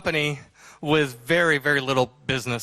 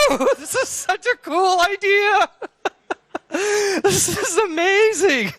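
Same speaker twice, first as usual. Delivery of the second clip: much higher, louder, slow whooping cheer with a laugh; hooping and hollering; 4 dB louder, slower than usual. Retold or imitated. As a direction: whoop it up like you just struck gold. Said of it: "we started hooping and hollering".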